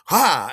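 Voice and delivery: loud sudden "ha!"; triumphant "ha". Retold or imitated. Dancer landing a turnaround in front of them, triumphant "ha!". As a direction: punch out one loud, showy "ha".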